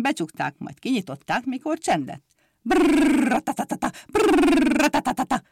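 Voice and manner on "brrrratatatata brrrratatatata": high, slow rolling brrr-tatata; motorbike buzz imitation. The little mimic copies the motorbike's roar. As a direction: sputter like an engine.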